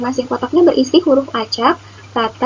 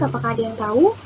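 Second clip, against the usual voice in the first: ordinary delivery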